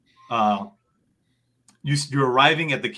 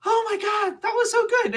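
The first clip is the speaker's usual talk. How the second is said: much higher squeal of delight; excited creature voice. Acted. Bunso Tuko thrilled after the fight, high squeal. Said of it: "Bunso Tuko comes out and he goes".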